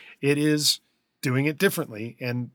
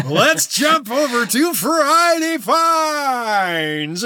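slow, higher show host voice; announcer voice; 12 semitones higher, 6 dB louder, much slower than usual. Acted. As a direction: announce the segment brightly.

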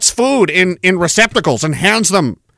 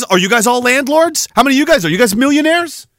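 normal talking voice

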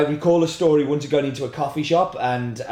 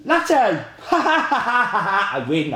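triumphant laugh; 5 semitones higher, much slower than usual. Real, not acted. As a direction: cheer the win and laugh. Wheel lands, laughs.

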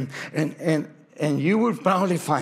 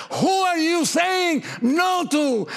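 God rebuking; 11 semitones higher, slower than usual. Acted. Repeated rebuke question.

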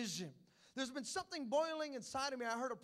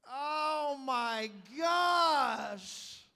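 shocked realization; 3 semitones higher, 10 dB louder, much slower than usual. Retold or imitated. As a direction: stretch out the discovery. Realizing glasses on his head.

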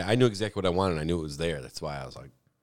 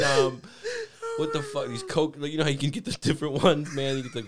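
ordinary talk

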